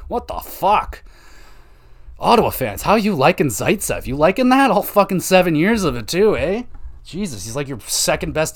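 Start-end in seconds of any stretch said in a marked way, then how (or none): 2.2-6.6 taunting. Acted. Taunting Ottawa fans over Zaitsev.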